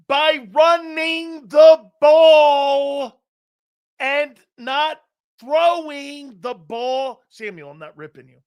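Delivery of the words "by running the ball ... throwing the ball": loud, slow, drawn-out point; emphatic shout. Real, not acted. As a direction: hammer the point home to the caller. Arguing with caller, emphatic.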